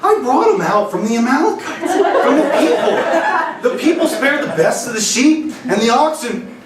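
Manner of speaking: high, laughing whiny excuse voice; excusing Saul. Acted. Preacher voicing Saul defending himself to Samuel, high and laughing. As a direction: play Saul as a giggling kid making excuses. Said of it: "And Saul said".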